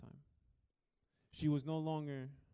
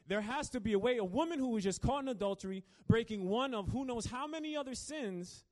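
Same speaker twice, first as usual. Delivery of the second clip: higher, louder preaching, pressing forward; preaching at full force; 9 semitones higher, 5 dB louder than usual. Acted. Preacher driving home the point to the congregation, fervent. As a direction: build the point with rising fervor.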